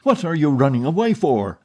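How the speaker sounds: put-on a man yelling at a fleeing girl; furious voice. A narrator's reading. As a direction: chase her down with the question. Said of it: "asked the furious voice".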